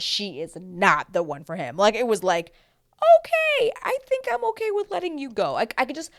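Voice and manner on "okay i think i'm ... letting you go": very high sing-out, loud at first; exaggerated high-pitched letting-down voice; mock agreeable. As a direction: squeak out an over-bright okay, then ease down. Mock bachelor happily releasing her.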